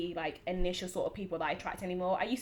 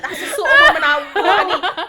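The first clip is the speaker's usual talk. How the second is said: mock guy voice; 11 semitones higher, 15 dB louder than usual. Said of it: "You're like".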